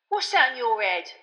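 sharp, sudden question; abrupt question. A narrator's reading. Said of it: "said Stan abruptly".

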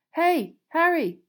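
loud, high, drawn-out call. A narrator's reading. Cedric calls to Harry up the marble staircase, calling out. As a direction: call after someone some way off. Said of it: "heard someone calling him".

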